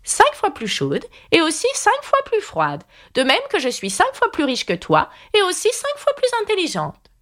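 high, smug queen voice; Red Queen boasting. A narrator's reading. Red Queen bragging to Alice, in a high smug voice. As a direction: boast up high, savouring each cinq fois.

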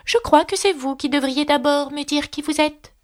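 high, dignified declaration; solemn. A narrator's reading. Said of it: "déclara d'un ton solennel". (ton solennel, voix aiguë)

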